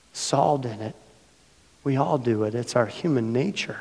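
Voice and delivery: ordinary talk, nothing marked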